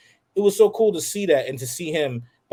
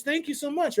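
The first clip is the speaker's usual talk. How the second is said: grateful mom; 12 semitones higher, 5 dB quieter than usual. Retold or imitated. Mom thanking the boy.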